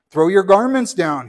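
loud, cross demand from a vain donkey; cross shout. A narrator's reading. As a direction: bark it at the crowd, offended. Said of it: "he said crossly".

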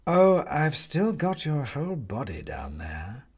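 slow, purring menace of a cat; prowling cat. A narrator's reading. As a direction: savour it slowly, like a cat playing. Cat taunts.

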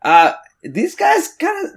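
high, offended what the fuck; indignant surprise. Acted. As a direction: take the snub personally. Offended after the scoff.

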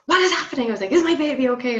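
high, loud panicked call to the room; frantic shout at the midwives over the beeping; retold shouting. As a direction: shout it out in alarm at the staff. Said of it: "like, shouting at them".

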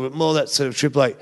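normal talking voice

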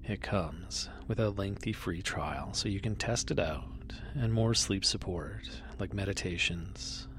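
ordinary delivery

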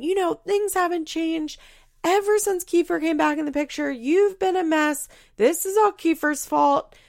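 a hint of an angry mother telling her off; mock yelling mom. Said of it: "Barbara starts yelling".

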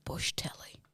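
whispered, voiceless repeat; quietly mulling over the phrase; musing whisper. Real, not acted. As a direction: whisper it to yourself, pondering. Pondering the phrase to self.

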